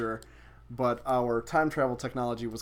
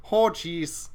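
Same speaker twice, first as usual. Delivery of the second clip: Minnesota accent; 4 semitones higher, 5 dB louder than usual. Shown as a demonstration. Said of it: "Do a Minnesotan accent".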